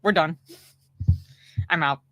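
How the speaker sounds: normal talking voice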